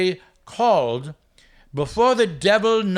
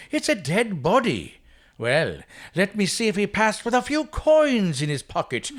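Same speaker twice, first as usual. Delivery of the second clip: hammed-up Irish brogue, high and drunk; Irish accent; 5 semitones higher than usual. Stingy Jack stumbles on a body in a hammed-up Irish accent. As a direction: ham up a stage Irishman staggering home from the pub. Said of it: "I'm gonna play Stingy Jack with my best, uh, possible Irish accent".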